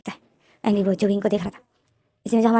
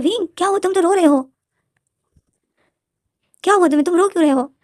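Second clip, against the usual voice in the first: higher, concerned partner's voice; worried asking; 8 semitones higher than usual. Retold or imitated. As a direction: coax the crying friend gently but anxiously. Jan finds Wing crying. (ऊँची, चिंतित आवाज़ में पूछना)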